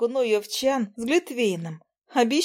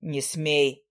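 lower, firm command; stern rebuke; 7 semitones lower than usual. A narrator's reading. Demian sternly checks the cheeky demon, lower. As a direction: stop him cold. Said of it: "строго одёрнул нахала Дэмиан".

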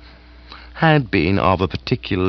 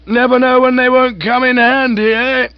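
higher, louder, gushing aged aunt; hearty aunt voice; 6 dB louder than usual. Acted. Aunt gushing to Bertie about lunch. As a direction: raise the pitch and relish the food.

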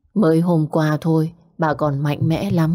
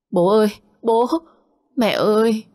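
wailing cry; 6 semitones higher than usual. A narrator's reading. Daughter runs home to her dead father, wailing. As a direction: wail for father and mother. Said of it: "bỗng vang lên tiếng khóc thét".

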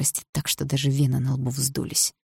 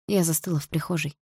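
ordinary delivery, nothing marked

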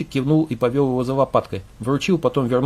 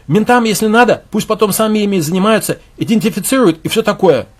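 loud, higher, dismissive; raised, careless insisting; brash arguing; 7 semitones higher, 6 dB louder than usual. Acted. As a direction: push the point loudly. Khronov keeps arguing loudly.

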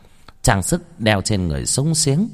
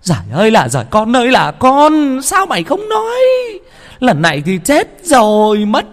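despairing wail; 7 dB louder than usual. Acted. Mother wailing at the disaster.

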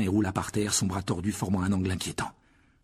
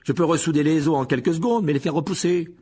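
loud, higher-pitched scolding; furious; 6 semitones higher, 7 dB louder than usual. A narrator's reading. Madame Pomme Fraîche over Harry's boneless arm, furious. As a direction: keep fuming at full volume. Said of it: "Madame Pomme Fraîche était furieuse".

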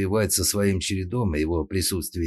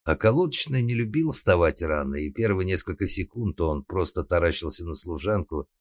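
ordinary delivery, nothing marked

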